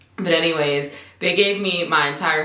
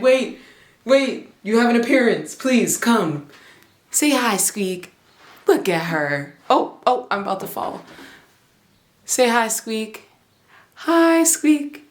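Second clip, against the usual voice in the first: high, sing-song coaxing to a kitten; coaxing pet voice; 5 semitones higher than usual. Real, not acted. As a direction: coax the kitten to greet the camera.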